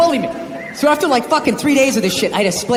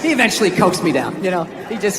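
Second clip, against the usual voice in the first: ordinary talk, nothing marked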